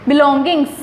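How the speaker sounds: usual speaking voice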